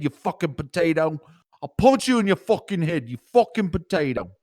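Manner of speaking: high, loud put-on Manchester lad. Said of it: "listening to too much Oasis".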